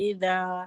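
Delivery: اذا with a light, gentle ذ; soft dhal. Shown as a demonstration. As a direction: correct the pupil with a soft ذ in اذا. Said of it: "نرمی سے پڑھیے". (اذا کی ذال نرمی سے)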